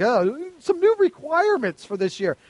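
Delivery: high-pitched imitation of students; mock reaction. Teacher voicing students facing new school rules, high mock reaction. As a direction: pitch it up, playfully mimicking a teenager.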